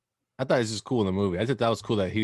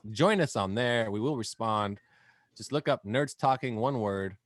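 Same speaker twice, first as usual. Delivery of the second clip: outro announcer read; 2 semitones higher, 3 dB louder than usual. Acted.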